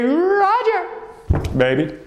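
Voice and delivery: high stretched announcer call on Roger; showman handoff. Acted. Host handing over to Roger, stretched high showman call. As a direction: sing his name out high like a game show host.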